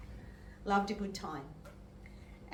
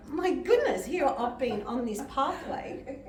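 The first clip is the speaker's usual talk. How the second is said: laughing; 4 semitones higher, 6 dB louder than usual. Retold or imitated. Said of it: "and I thought".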